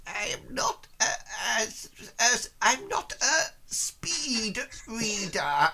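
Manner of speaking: put-on high, slow, hesitant old lady reading aloud; old woman reading. Old woman squinting at the T-shirt, reading slowly. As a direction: sound out the words slowly like a poor reader. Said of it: "she sort of goes".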